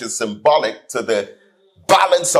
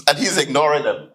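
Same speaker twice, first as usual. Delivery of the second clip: usual speaking voice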